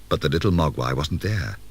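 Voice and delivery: plain reading voice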